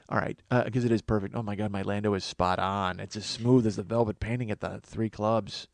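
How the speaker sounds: lightly voiced smooth suave low-key talk; a hint of a smooth ladies' man; Lando Calrissian voice. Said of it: "What if I did it as Lando Calrissian?".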